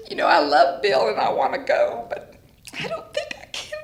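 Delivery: high whiny plea that climbs; whiny younger self. Retold or imitated. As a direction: play your panicked self, pleading. Retold whiny plea to Tom.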